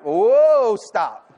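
loud, high drawn-out "whoa", then a stop; mock-alarmed, cutting him off; alarmed protest. Preacher acting out his reply to coworker's dream, alarmed protest.

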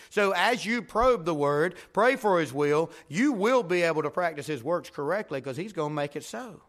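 fired-up pulpit preaching; preaching at full force. Acted. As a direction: drive the story home to the congregation. Exhorting the congregation.

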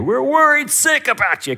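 high, loud scolding; worried dad. Retold or imitated. Dad scolding his runaway twelve-year-old, loud and high. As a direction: scold like a frantic father. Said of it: "as the dad, I'm going".

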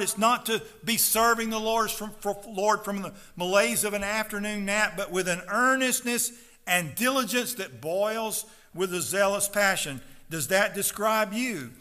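ordinary delivery

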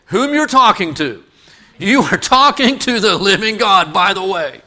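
high, loud, incredulous preaching; full-force preaching. Real, not acted. Preacher indignant at the high priest's demand, full-force preaching. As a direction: preach it loud, pitched well up.

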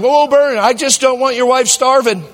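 high, loud pleading; hammed-up worried pastor; mock alarmed. Pastor backing off from his friend, high and alarmed.

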